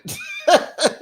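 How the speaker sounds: shrill giggle burst; high laughing squeal. Real, not acted. Vlogger squealing with laughter after her call.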